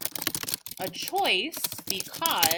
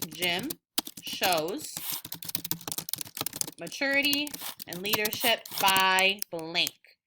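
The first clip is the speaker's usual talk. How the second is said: slow, spaced-out answer frame; teacher spelling out a sentence starter; slow dictation. Shown as a demonstration. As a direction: dictate each word so students can copy. Models answer frame slowly.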